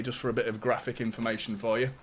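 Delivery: ordinary talk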